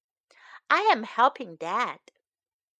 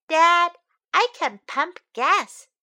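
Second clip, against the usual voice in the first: put-on eager child; 6 semitones higher, 6 dB louder than usual.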